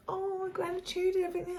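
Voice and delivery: high, soft sing-song mock of gratitude talk; mocking dismissive. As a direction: parody the eye-rolling skeptic. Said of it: "it sounds a little bit like".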